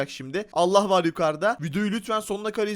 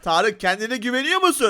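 normal talking voice

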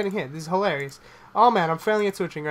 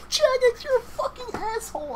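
shrieking rage. Real, not acted. Loses big stake, rages at Jagex.

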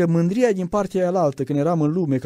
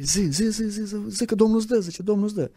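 mock urgent urging; 5 semitones higher than usual. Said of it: "alții la urechi zice".

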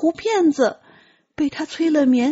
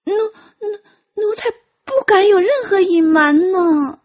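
stammering through tears; a scared servant near crying, tripping on the first word; frightened tearful stammer; 3 semitones higher than usual. Acted. Frightened servant stammers before emperor. (带着哭腔，害怕地结巴)

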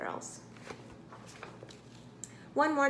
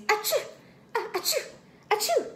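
high, squeaky little monkey sneezes; acted sneezes; 11 semitones higher, 3 dB louder than usual. A narrator's reading. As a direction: sneeze in quick high bursts. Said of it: "began to sneeze".

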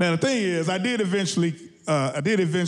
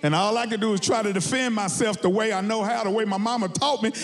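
ordinary talk, nothing marked

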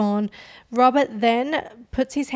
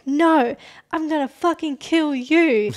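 higher, louder imitated angry retort; defiant threat; 4 semitones higher, 4 dB louder, slower than usual. Kaylee to Robert after being strangled, acted fierce defiance. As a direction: throw the threat back hard, raised in pitch.

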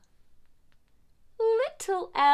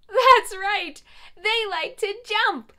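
cheery encouragement; 8 semitones higher, 5 dB louder than usual. Acted. Cheering kids on.

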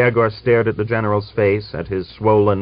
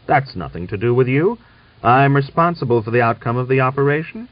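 forceful; 6 semitones higher, 5 dB louder, faster than usual. A narrator's reading. General asserts authority.

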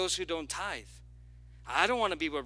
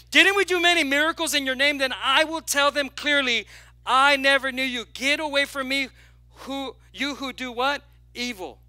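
loud, high preaching of the verse; full-force preaching; 6 semitones higher, 8 dB louder than usual. Acted. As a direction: proclaim it to the whole church.

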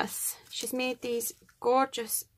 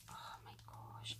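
awed whisper; 23 dB quieter than usual. Real, not acted. Unpacking a gift parcel, awed.

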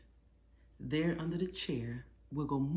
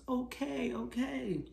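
put-on higher, giggly child voice; tickled child; 9 semitones higher, slower than usual. Little boy tickled by mom gives up, higher voice. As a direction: squeal surrender while tickled.

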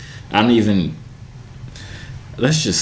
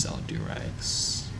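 whisper; 14 dB quieter, much slower than usual. Acted. Secret reveal to fans.

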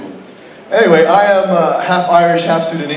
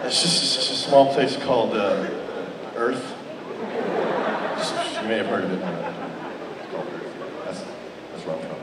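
hushed mock secret; 3 semitones lower, 9 dB quieter than usual. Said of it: "I'm like".

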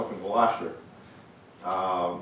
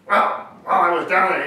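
imitating a friend's gravelly voice, higher and louder; raised, lightly gravelled retelling of a friend; 8 semitones higher, 9 dB louder than usual. Fisherman retells soaked friend's excuse to a laughing audience, imitated gravelly voice. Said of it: "in his gravelly voice".

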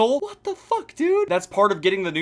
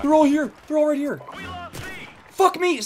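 high loud panic; panicked yelling; 13 semitones higher, 3 dB louder than usual. Real, not acted. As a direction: yell as enemies swarm.

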